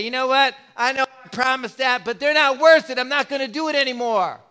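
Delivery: high-pitched, acted exasperation; imagined frustrated outburst; mock frustration. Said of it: "get frustrated with you and me".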